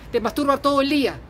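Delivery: normal talking voice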